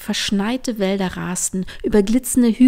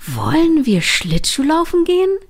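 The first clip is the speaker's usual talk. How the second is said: high, loud, excited question; sudden eager call; 8 semitones higher, 6 dB louder than usual. A narrator's reading. Trini suggests ice skating to Line, eager high call. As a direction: call out an exciting idea, pitched up. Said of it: "rief Trini plötzlich, ein Funkeln in den Augen".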